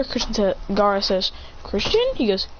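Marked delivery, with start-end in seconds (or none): 1.6-2.1 much higher rising surprised call; startled high question. Acted. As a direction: gasp out his name in disbelief.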